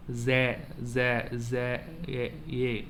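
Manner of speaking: Z then A, drawn apart and held; spelling the sound. Shown as a demonstration. Teacher sounding out zero letter by letter, held. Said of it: "will have the sound of A".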